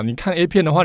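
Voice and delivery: usual speaking voice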